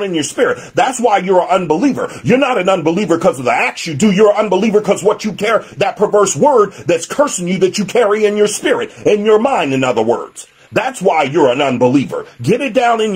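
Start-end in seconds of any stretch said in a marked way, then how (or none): none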